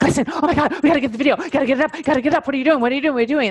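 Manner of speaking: high, frantic hammed-up boss panicking; mock panic. Boss panicking at staff about the video, hammed-up high frantic. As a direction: play a boss losing it over a late video, in a mock frenzy. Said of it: "there's this panic around it".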